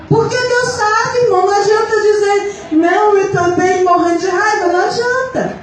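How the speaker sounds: high-pitched, insistent preacher's delivery; impassioned preaching. Real, not acted. Confessing anger to the Lord before the church, impassioned preaching. Preaches, acting out own prayer.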